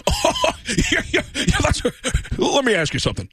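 big airy laugh into a question; laugh-into-question talk show host voice; Tom Snyder. Shown as a demonstration. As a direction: come out of a laugh straight into asking. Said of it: "teach someone how to do a Tom Snyder impression".

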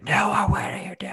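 high-pitched mock-frantic yell; hammed-up high shouty scolding; shouting, as counterexample. Shown as a demonstration. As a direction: demonstrate an overwrought parent's shout. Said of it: "but I'm not shouting".

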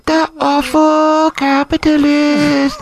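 slow, high, grandiose propaganda chant; ranting dictator speech. Pretend. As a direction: proclaim it slowly like state propaganda. Mock North Korean propaganda chant.

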